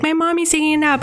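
put-on high, fast childlike voice; little daughter voice. Daughter answers the phone, in a mild high child's voice. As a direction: imitate a small girl on the phone.

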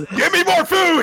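very high, loud comic yell; mock demanding scream. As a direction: scream for food, half laughing. Food demand acted out.

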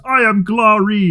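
slow, proud declaration; grand hero voice. Retold or imitated. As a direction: announce it like a pompous hero. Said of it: "he turns and goes".

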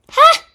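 high loud cackle; one sharp scornful laugh; shrill mocking laugh. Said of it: "laughed Lucy the goose".

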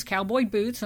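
ordinary delivery

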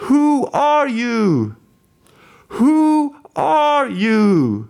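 high, slow, drawn-out call across the water. Retold or imitated. Motorcyclist calling across the Suez Canal to Lawrence, high slow shout. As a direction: shout the question long and high like a distant stranger. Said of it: "Och så ropar han".

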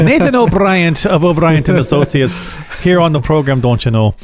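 playful mock Irish brogue on "O'Brien"; a light-hearted stage Irish "don't you know?"; hammed-up Irish accent. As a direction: lay on a jokey Irish accent. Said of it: "I didn't quite do the Irish. I should have done it".

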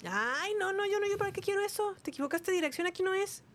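high, soft put-on voice; high comic voice. Sketch character refusing delivery.

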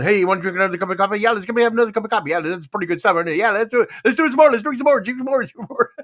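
fast, high, caffeine-buzzed chatter; wired and giddy. Retold or imitated. Him and Grammy buzzed on Indian coffee, hyper and giddy. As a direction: rattle it off like you're flying on coffee. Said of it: "we ended up going".

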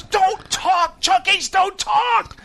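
very high, hammed-up scolding; mock yell at a misbehaving mascot; strained, high-pitched host imitation.

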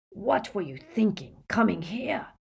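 exasperated, gruff barman. A narrator's reading. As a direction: scold them gruffly for their folly. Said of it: "he said gruffly".

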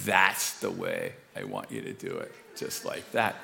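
voice of God. Retold or imitated. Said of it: "And the Lord says".

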